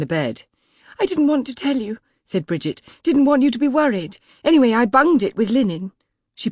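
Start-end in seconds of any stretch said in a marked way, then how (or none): 1.0-2.0 much higher, fretting maid, relieved; a flustered servant's high voice; anxious maid. A narrator's reading. Maid Bridget's lines. 3.0-5.9 much higher, fretting maid, relieved; a flustered servant's high voice; anxious maid. A narrator's reading. Maid Bridget's lines.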